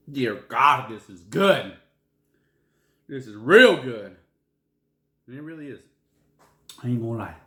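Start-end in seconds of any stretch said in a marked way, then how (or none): 0.1-4.2 translation, loud. Shown as a demonstration. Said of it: "that means".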